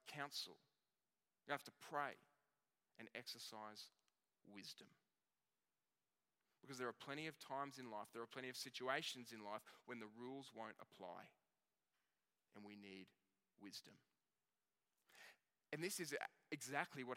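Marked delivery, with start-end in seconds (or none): 3.0-4.9 soft emphasis. Real, not acted. Pastor urging the congregation, softly and weightily. Pastor stressing need for wisdom. 12.5-13.9 soft emphasis. Real, not acted. Pastor urging the congregation, softly and weightily. Pastor stressing need for wisdom.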